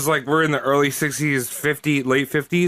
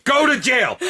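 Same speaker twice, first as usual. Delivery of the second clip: high mock order; mock command; 11 semitones higher than usual. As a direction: sentence them jokingly. Mock sentencing the fans.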